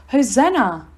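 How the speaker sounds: an understated cheer from a frenzied crowd; mild crowd shout. A narrator's reading. Narrator voices the crowd greeting Jesus in Jerusalem, mild shout. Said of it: "a frenzied crowd shouts".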